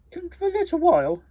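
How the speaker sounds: high, fast, breezy; kids brushing off. Acted. Nephews putting off Grandma Duck, high and quick. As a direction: toss it off quickly, sky-high.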